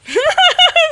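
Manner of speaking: high, loud burst of laughter; sudden laugh. Real, not acted. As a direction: crack up loudly. Real laughing mid-game.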